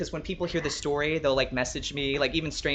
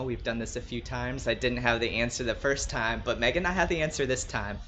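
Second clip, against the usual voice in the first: ordinary talk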